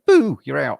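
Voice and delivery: spring it on them like creeping up behind someone; sneaky mock boo. Host mimics a sneaky run-out, mock spooky. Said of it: "kind of going".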